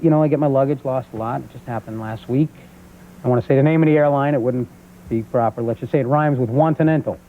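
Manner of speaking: ordinary delivery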